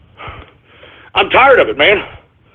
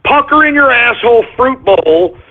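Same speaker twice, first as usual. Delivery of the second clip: ordinary delivery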